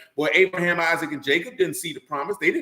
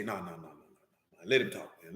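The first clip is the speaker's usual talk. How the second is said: much lower, softer restraining voice; David calm restraint; 11 semitones lower, 12 dB quieter than usual. Retold or imitated. King David calming his eager servant, low and soft. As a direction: hold your men back gently. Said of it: "David say".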